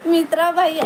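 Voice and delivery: ordinary delivery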